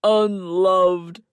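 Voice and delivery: loud, drawn-out mock-tragic moan; overacted misery. A narrator's reading. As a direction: wail it like a drama king. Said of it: "he moaned".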